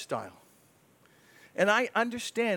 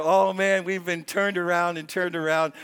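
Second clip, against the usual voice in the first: louder, exasperated groan of frustration; mock anger; 7 dB louder than usual. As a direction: vent it like a fed-up complainer. Acting out his angry thoughts.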